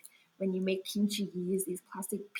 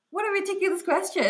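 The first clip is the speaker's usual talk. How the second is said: high, quick, amused dismissal; laughing disbelief; 11 semitones higher, 4 dB louder, faster than usual. Real, not acted. Reacting to the kimchi question, laughing disbelief. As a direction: scoff at it and break into laughter. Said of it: "I just laughed at that".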